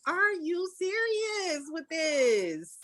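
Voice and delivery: slow, high disbelieving question; incredulous. Retold or imitated. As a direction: stretch it out in mock disbelief. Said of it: "Like, I was like".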